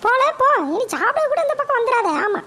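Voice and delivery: high-pitched mother fuming about her son; shrill-pitched scolding mum, fed up; annoyed mother. Acted. Mother complains to grandma about her son, high and irritated.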